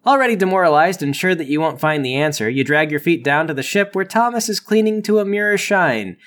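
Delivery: ordinary delivery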